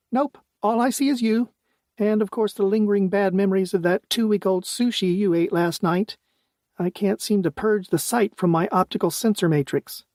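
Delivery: bright, high-pitched AI companion. A narrator's reading. AI Fitzy teasing.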